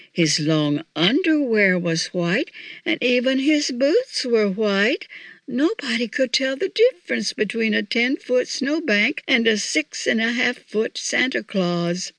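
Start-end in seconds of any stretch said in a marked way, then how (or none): none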